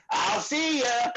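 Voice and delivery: high, slow little goodbye; imitating the Automator. Comic imitating a weird comic saying goodbye, high and slow. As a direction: squeak it out slowly, then slink off. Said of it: "the Automator was just like".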